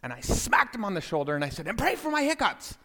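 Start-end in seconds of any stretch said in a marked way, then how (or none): 1.7-2.8 very high, squeaky plea; comic desperate request in a raised voice; urgent demand. Retold or imitated. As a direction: hiss it high and strained as an urgent prompt. Interrupts grace with urgent demand.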